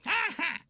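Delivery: high, loud short gleeful laugh; bratty doll chuckling at the others; baby giggle. Acted. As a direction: let out a smug little baby laugh. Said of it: "grinned Pamper".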